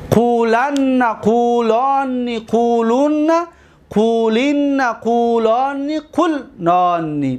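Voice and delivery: slow chant-like drill with held syllables; paradigm recitation. Shown as a demonstration. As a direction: recite each form drawn out, leaning on the doubled n.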